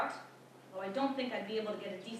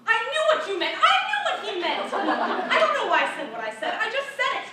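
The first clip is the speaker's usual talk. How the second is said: high, loud defensive outburst; flustered, furious yelling; shouting; 10 semitones higher, 10 dB louder than usual. Acted. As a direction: shout it at him, indignant. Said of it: "I shouted at him".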